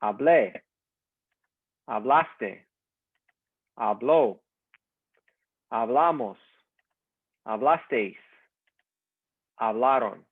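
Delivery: each form of Hablar said alone and clearly, a pause after each, for the class to repeat; slow model pronunciation. Shown as a demonstration. Teacher models Hablar forms for students to repeat, slow and clear. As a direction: model each word slowly for students to echo. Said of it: "repeat after me".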